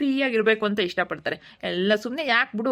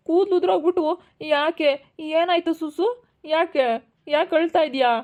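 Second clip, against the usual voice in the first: higher, urgent worried questions; alarmed concern; 8 semitones higher, slower than usual. Acted. Asking the crying friend what happened, high and alarmed. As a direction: rush in alarmed at her tears.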